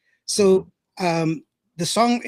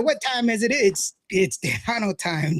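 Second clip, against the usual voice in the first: normal talking voice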